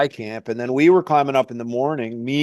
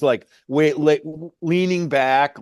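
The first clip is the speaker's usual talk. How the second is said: ordinary delivery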